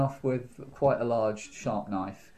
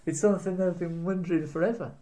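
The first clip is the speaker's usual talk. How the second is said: ordinary talk, nothing marked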